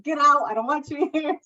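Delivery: half-laughing, kick him out; mock angry yell. Mother in labour kicking her husband out, mock yell. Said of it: "I was kicking my husband out".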